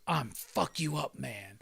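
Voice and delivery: low, slow superhero introduction; gruff-hero parody. Acted. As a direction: announce yourself slowly, dropped down low. Superhero announces his name.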